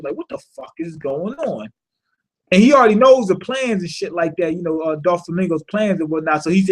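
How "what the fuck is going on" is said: softer, hushed, said to himself; inner thought. Retold or imitated. Virgo thinking to himself, hushed and soft. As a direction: let it drop to a hushed private thought. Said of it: "That's what Virgo's thinking".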